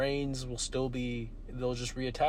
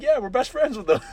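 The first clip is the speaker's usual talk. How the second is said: cheery future person; 11 semitones higher, 11 dB louder than usual. Pretend. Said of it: "They're like".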